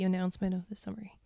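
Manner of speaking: hushed spooky whisper. Shown as a demonstration. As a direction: whisper it like a ghost story. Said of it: "give me your spooky".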